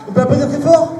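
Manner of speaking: high, loud call to the crowd. Acted. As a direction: get the room clapping. Calling audience to applaud.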